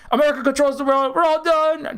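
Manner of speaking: high mock despair that the world is lost; mock doom reaction. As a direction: parody a panicked doomer. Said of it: "it's a immediate reaction".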